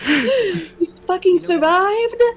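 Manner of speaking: high, slow incredulous question; slow, high stunned question; surprised. Real, not acted. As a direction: drag it out in disbelief. Reader reacts to the survivor.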